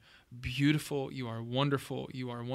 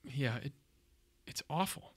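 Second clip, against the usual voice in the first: shaky sigh; 4 dB quieter than usual. Real, not acted. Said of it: "I'm trying not to cry".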